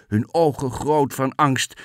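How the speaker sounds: ordinary delivery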